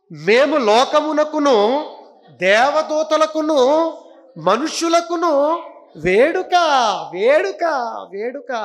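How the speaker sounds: high, loud, slow verse declamation; declaiming scripture. Acted. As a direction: proclaim each word of the verse.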